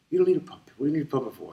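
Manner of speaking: high, loud retold father's outburst; father refusing. Father loudly refusing his son's puppet request. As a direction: bark it like a dad who won't budge.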